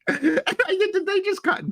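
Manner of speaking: laughing through the words, voice shooting up high; high-pitched giggly telling, cracking up. Real, not acted. Laughing while telling the story.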